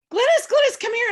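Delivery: high, loud excited calling out of a name; husband yelling. Retold or imitated. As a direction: call her from the next room, worked up. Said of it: "he comes yelling out to me".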